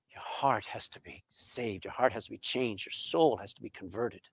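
soft low urgent plea; hushed earnest. Real, not acted. Preacher pleading softly with the congregation, hushed. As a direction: drop it down and press it home. Sermon point, dropped voice.

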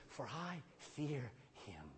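low, soft and slow, almost breathed; hushed fearful prayer. Acted. Preacher voicing Jacob's fear, hushed and slow. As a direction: speak it like a frightened man praying.

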